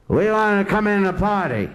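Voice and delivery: loud, rowdy yell with a laugh in it; gang calling from the street. Retold or imitated. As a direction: shout it up from the street. Gang shouting outside.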